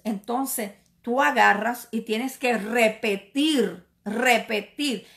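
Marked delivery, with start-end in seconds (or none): none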